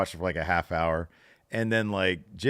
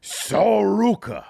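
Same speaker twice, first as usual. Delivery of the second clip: high, loud, drawn-out name call; announcer shout; 14 semitones higher, 10 dB louder, much slower than usual. Pretend. Answering co-host's "Sorry, who?", playful announcer shout. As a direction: belt the name like a hyped-up announcer.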